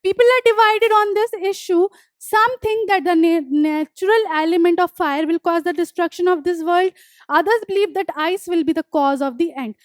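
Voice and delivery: deliberate dictation pace, each word spaced; slow textbook reading. Acted. Teacher reading the poem notes aloud to students, slow and deliberate. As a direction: read the line out slowly for the class to copy. Reads textbook line slowly.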